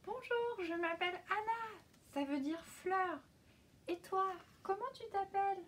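put-on high, bright child's voice; little girl voice. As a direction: speak as a curious little girl. Anna greets a hidden voice.

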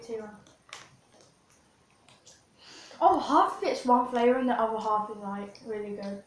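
chewy candy in mouth; talking while chewing. Real, not acted. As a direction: mouth partly full. Seen 0: left speaker ate gummy just before, cheeks still chewing after.

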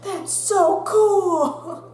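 put-on high, slow awed kid voice; drawn-out wow from amazed classmates; impressed kids. Classmates impressed by the broken tooth, awed. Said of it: "they're like".

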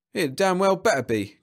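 loud, irritated policeman; growled. A narrator's reading. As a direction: warn him like an angry cop. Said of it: "growled the policeman".

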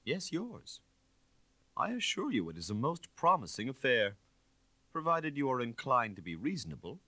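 sly inspector. Acted. Inspector pressing his threat.

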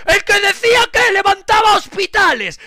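high, loud sneering yell; mocking shout. Real, not acted. Streamer mocking a critic, loud sneering yell. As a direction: scoff at him loudly.

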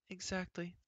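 high, soft queen reply; fussy queen. A narrator's reading. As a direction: briskly agree. Queen replies.

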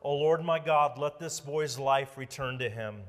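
usual speaking voice

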